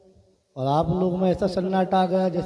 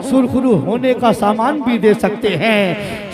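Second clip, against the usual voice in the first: measured verse recitation; 3 semitones higher, 6 dB louder, slower than usual. Real, not acted. Preacher introducing a line of verse, measured recitation. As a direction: draw the line out, easing down to a falling end.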